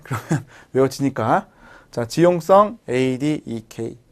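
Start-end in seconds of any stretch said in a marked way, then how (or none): none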